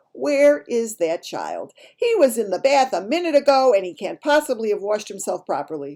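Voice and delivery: loud, high scolding; exasperated mother. A narrator's reading. Mother searching for her child at bath time, exasperated.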